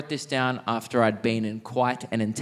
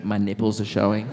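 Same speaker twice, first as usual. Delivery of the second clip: ordinary delivery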